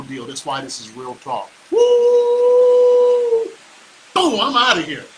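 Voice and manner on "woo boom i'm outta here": high whoop, then louder punchy exit; excited whoop. Real, not acted. Host whooping goodbye to the stream, loud and excited. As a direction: sign off with a victory yell.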